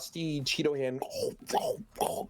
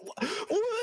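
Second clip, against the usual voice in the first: much higher, yelped "what?"; startled protest. Retold or imitated. Friend teased about staying on the call, high startled yelp. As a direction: caught off guard and flustered.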